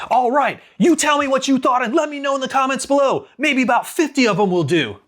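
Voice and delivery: hammed-up gym coach hyping the crowd; obnoxious exercise coach. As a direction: bark it like an over-the-top fitness trainer. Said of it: "You are an obnoxious exercise coach".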